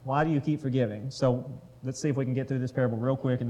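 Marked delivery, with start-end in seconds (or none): none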